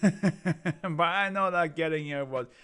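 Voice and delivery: put-on Italian accent with a chuckle. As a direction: sly laugh through an Italian accent. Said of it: "my Italian accent".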